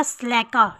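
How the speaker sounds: स्लैकर said slɛka, no r at the end; स्लैकर as slɛka, the final r left silent; slacker with silent r. Shown as a demonstration. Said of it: "आज silent होगा".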